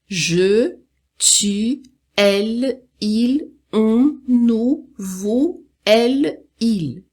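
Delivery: "je, tu, elle, il, on, nous, vous, elle, il" evenly spaced; French pronunciation drill. Shown as a demonstration. Teacher leading students through pronouns again, spaced drill. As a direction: recite "je" through "il" one by one for repetition. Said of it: "vamos pronunciar mais uma vez".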